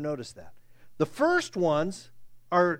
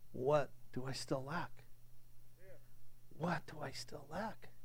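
soft searching; 4 semitones lower, 15 dB quieter than usual. Acted. Man's inner longing, questioning.

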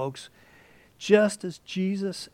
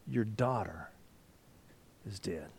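low, soft, somber delivery; grim news; 8 semitones lower, 9 dB quieter than usual. Acted. Messenger telling the father his daughter died, somber. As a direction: drop it low and quiet like bad news.

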